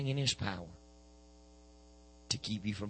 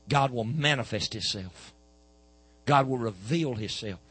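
louder, slower pulpit proclamation; slow forceful preaching; 4 dB louder, slower than usual. Acted. Preacher proclaiming God's revelation to the church, slow and loud. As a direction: press each word on the congregation.

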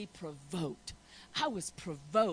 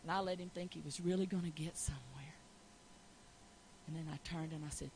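whisper; 6 semitones lower, 7 dB quieter, faster than usual. Real, not acted. Whispered telling.

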